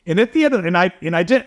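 usual speaking voice

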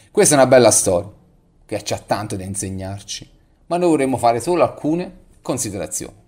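ordinary talk, nothing marked

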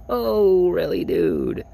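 high, squeaky mock disbelief; sarcastic. Teen mocking the boss's praise, sarcastic. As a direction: play it incredulous and sarcastic.

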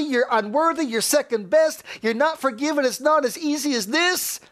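high, driving preacher's cry; fiery preaching. Acted. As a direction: build into a relentless accusing tirade. Preaches the accusing voices rising up.

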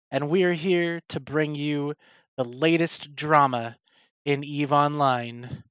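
slow, big show-host intro; announcer voice. Acted.

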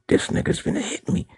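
high, breathy frightened thought; scared thought. Retold or imitated. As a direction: voice the boss's fear in his head. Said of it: "the fear in his eyes".

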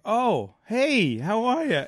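hammed-up surprise hello; fake cheerful. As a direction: act overjoyed to see her. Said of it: "I'm like".

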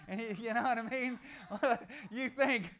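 chuckling through the words; laughing. Real, not acted. Preacher laughing at his own pocket-change story, laughing.

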